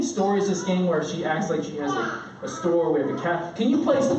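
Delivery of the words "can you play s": put-on pleading young daughter. Daughter begging dad to play.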